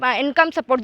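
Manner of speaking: ordinary talk, nothing marked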